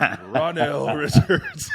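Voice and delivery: drawn-out, booming fighter introduction, laughing; ring announcer. Pretend. Host introducing a guest as a boxer, booming announcer voice. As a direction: boom the name like a ring announcer.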